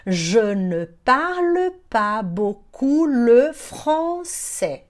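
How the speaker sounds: very slow, syllable by syllable; slow teaching demo. Shown as a demonstration. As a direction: draw out every syllable for learners. Teacher models phrase slowly. (demonstração sílaba por sílaba)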